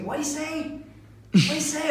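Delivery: high-pitched, insistent repeated question; mock pestering passenger. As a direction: imitate someone who never hears. Passenger who can't hear, repeats question.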